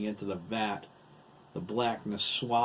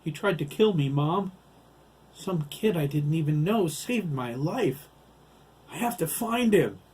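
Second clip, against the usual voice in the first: loud, high, frantic telling of near death; desperate plea; 8 semitones higher, 8 dB louder than usual. Acted. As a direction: cry it out to mom, shaken and urgent. Boy begs his mother, desperate.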